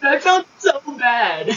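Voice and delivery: laugh-crying through the words. Real, not acted. Telling friends the embarrassing mistake, laugh-crying.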